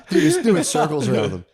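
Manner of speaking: can't hold back the laugh; delighted laughter while telling the story. Real, not acted. Laughing at Cortez's scheme.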